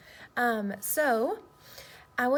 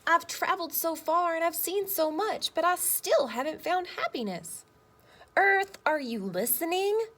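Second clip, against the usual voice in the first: put-on higher, louder, the boy calling out desperately; boy crying out; 7 semitones higher, 5 dB louder than usual. Boy in the story calls out to the earth, raised voice. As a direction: call out to the earth with longing. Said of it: "cried the boy".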